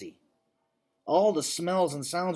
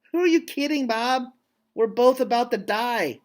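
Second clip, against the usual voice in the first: higher, louder incredulous scoff; scoffing sister; 8 semitones higher, 5 dB louder than usual. Acted. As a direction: scoff at him in disbelief, high and loud.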